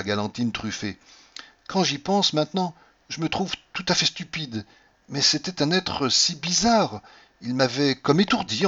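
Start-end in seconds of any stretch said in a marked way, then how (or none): 1.7-8.7 lightly voiced heroine, higher pitch; wife confessing, in a slightly raised voice; Cordelia confiding. A narrator's reading. (Cordelia qui se confie, voix un peu haute)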